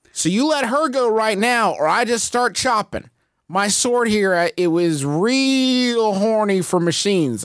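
high-pitched, cocky in-character challenge; brash monster-fighter. Acted. Player threatening the monster to free the guard, cocky and high.

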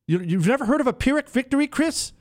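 high-pitched, quick disbelieving question; incredulous. Real, not acted. Host asks his producer about a famous phrase, incredulous.

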